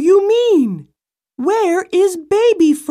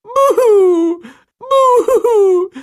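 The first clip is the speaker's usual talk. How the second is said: louder storybook boo-hoo wail; hammed-up sobbing; 3 semitones higher, 3 dB louder, slower than usual. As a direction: wail over the lost baby. Mother hears baby is dead.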